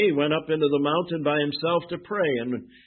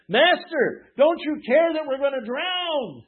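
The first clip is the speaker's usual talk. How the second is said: panicked plea; 10 semitones higher than usual. Acted. Disciples wake Jesus in storm.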